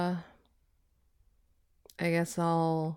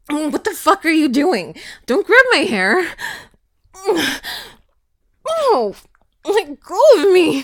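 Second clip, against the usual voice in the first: panicked shouting; 6 semitones higher, 10 dB louder than usual. Acted. Grabbed by the hair.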